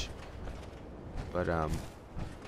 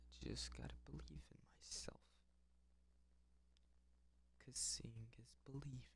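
hushed, breathy reassurance to himself; soft whispered pep talk; self-soothing whisper; 4 semitones higher, 30 dB quieter, faster than usual. Real, not acted. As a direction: whisper it to calm your nerves. Calming himself before risky move.

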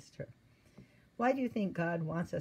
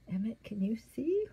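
whisper; 5 semitones higher than usual. Real, not acted. Grandmother whispering to her grandson over the picture. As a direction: lean in and whisper the question.

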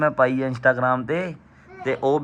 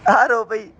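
high playful tattling voice, much higher; teasing singsong; 11 semitones higher than usual. Pretend. Telling mom that Preeti cried, high teasing singsong. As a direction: sing it out like a tattling kid.

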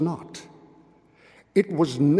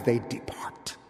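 whispered; 4 semitones lower, 9 dB quieter than usual. A narrator's reading. Soft laughter fading away.

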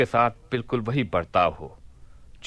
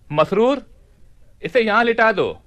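calling out; 9 semitones higher, 4 dB louder than usual. Acted. Caliph calling servant.

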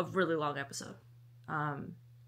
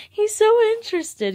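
high laughing delight; amused high squeal; 11 dB louder than usual. Real, not acted. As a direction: laugh through it at the dog. Laughing at dog.

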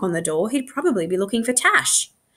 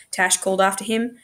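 plain reading voice

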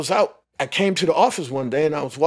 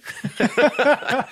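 a burst of high, delighted laughter; 10 semitones higher than usual. Real, not acted. Hearing he got the part, a real delighted laugh. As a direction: laugh out in disbelief at the offer.

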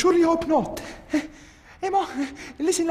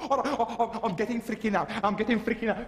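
normal talking voice

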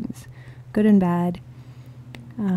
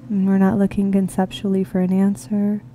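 guided meditation; 7 semitones lower than usual. Real, not acted. Meditation leader inviting listeners into the heart space, calm and unhurried. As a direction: ease them gently into this moment, leaving space between phrases.

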